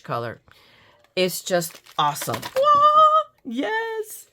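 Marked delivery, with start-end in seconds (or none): 2.4-3.3 very high, loud "wah" of joy; delighted squeal. Real, not acted. 3.4-4.3 high, soft squeaked cheer; delighted yes. Real, not acted. Delight at the product.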